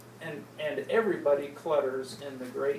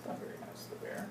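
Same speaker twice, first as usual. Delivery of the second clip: unvoiced whisper to the children; whispered aside; 14 dB quieter, much faster than usual. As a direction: lean in and whisper it. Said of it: "aside whispered to kids".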